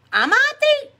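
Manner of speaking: very high, loud squeal of disbelief; playful high-pitched astonishment; mock incredulous. Real, not acted. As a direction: shoot way up into a startled question. Reacts to being called hot date.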